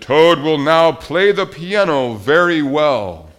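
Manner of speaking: loud, slow stage announcement; strange announcer. A narrator's reading. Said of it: "The strange voice said".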